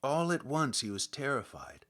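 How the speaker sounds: plain reading voice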